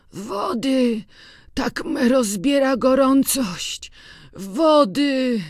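put-on high, strained plea of a feverish old farmhand; weak sick old man. A narrator's reading. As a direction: beg for water through the fever. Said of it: "słabym, schorzałym głosem". (chory parobek Kuba błaga o wodę słabym, schorzałym głosem)

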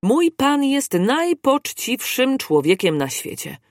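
high, slow emphatic protest; indignant outburst. A narrator's reading. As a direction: insist hotly on his master's honour. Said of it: "krzyknął obieży świat".

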